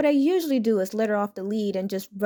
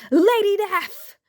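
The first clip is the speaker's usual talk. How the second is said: much higher, louder, slow drawn-out yell; gleeful yell; 10 semitones higher, 6 dB louder, much slower than usual. Retold or imitated. Little girl yelling her favorite comic with evil glee. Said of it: "with an evil glee, she yelled out".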